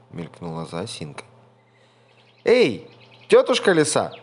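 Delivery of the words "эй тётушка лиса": high, loud hail; mouse calling out. A narrator's reading. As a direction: shout to the fox across the path. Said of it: "мышонок закричал".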